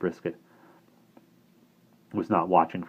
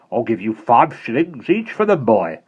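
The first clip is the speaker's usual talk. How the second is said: louder, hard commanding voice; stern headmistress; 4 semitones higher, 7 dB louder than usual. Acted. Missus Brisket ordering Bonnie, stern and suspicious. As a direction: order her about and eye the boy.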